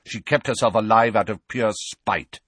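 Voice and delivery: loud, bitter retort; angry and emphatic; snapped. A narrator's reading. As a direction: spit it out resentfully. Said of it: "snapped Sirius".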